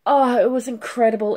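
ordinary delivery

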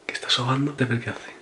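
hushed whisper at night. Real, not acted. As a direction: whisper so the sleeper stays asleep. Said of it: "no puedo hablar mucho más alto".

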